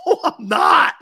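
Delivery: high, yelped denial; mock-indignant holler; retold loud protest. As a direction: shout it back in protest. Retelling his loud protest to Mike.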